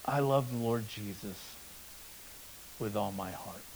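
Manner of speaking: trembling voice. Retold or imitated. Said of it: "with a trembling voice".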